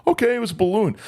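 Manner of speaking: high, hammed-up acceptance; mock gullible crowd. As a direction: play a gullible bystander agreeing. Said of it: "People are like".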